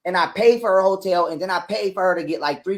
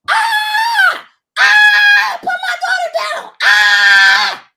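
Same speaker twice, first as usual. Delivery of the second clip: much higher, loud shrieking imitation of his ex; mocking her screaming; 8 dB louder, much slower than usual. Mocking his ex screaming at the door, hammed-up shrieks. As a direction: shriek it like a hysterical woman, mocking. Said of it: "She's screaming at the top of her lungs".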